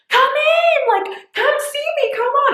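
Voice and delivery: high, loud call across the store; hollering at passers-by to come in; yelling to shoppers. Retold or imitated. Owner yelling from the counter to shoppers walking by, loud and high. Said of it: "I'm yelling from the counters".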